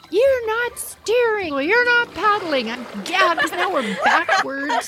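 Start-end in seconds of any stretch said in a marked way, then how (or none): 0.1-2.7 high-pitched mock yell at a partner; imitating a frantic yell in a canoe; retold yelling. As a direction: snap at her like she's useless at steering. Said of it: "I'm yelling at Madeline".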